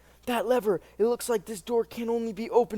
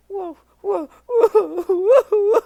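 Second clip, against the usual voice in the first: loud sobbing whoa, much higher; crying wail; 12 semitones higher, 10 dB louder, much slower than usual. Acted. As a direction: wail like a beaten kid. Boy bawling after beating.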